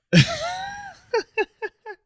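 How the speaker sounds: sudden high-pitched laugh breaking out; real high laugh after a joke; high squealing laugh. Real, not acted. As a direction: let a squeal of laughter escape. Big burst of laughter.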